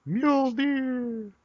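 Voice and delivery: high shout of Mjolnir; heroic shout. Pretend. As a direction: cry it out like Thor. Calling Thor's hammer.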